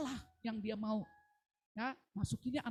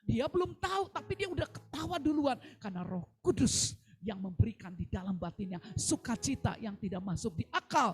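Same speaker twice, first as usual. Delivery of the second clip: loud, higher, jubilant sermon reveal; triumphant preaching; 4 semitones higher, 7 dB louder than usual. Real, not acted. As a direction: proclaim the miracle with full force. Preaching climax.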